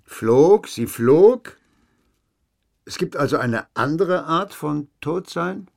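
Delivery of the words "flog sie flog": astonished. Acted. Astonished at flying dead woman.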